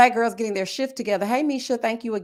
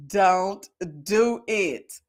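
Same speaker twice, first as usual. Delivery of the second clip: DON'T DO IT spaced word by word; very slow warning; 3 semitones lower, much slower than usual. Real, not acted. Host warning off a friend with kids, very slow warning. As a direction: stretch each word of "Don't do it" extremely long, lower intensity. Repeats warning even slower.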